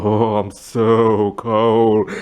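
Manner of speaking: very slow, drawn-out ghost moan; ghostly moan. Pretend. Ghost moaning to Lauren about being cold, very slow and drawn out. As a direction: stretch every word like a cold spirit.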